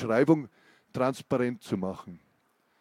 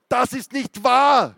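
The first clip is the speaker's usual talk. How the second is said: much higher and louder, slow outburst; sudden scream; 10 semitones higher, 7 dB louder, slower than usual. Acted. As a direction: explode with a drawn-out denial. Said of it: "schrie Renker unvermittelt los".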